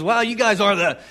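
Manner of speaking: retold burst of grateful excitement; excited quote. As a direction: blurt it out in thrilled amazement. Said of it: "but he's excited".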